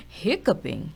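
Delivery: loud laugh then the word through it; amused chuckle at the idea; Father Bear laughs at Little Bear. A narrator's reading. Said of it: "Father Bear laughed".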